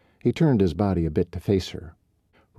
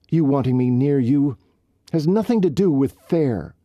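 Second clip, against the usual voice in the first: higher and louder, rising indignation; upset outburst; 7 semitones higher, 4 dB louder than usual. Acted. Riley venting her hurt at Colt, indignant and loud. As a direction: pour out every unfair thing, hurt and angry.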